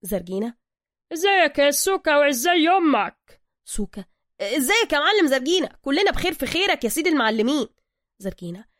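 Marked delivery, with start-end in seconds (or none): 1.1-3.4 higher, louder workshop boss; gruff master mechanic. Acted. Zergina greeting Soka in his workshop, higher and louder. 4.4-7.7 higher, louder eager praise; butter up the boss; flattering Soka. Acted. Soka flattering master Zergina, higher and louder.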